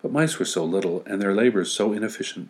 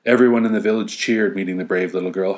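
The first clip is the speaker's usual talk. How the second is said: usual speaking voice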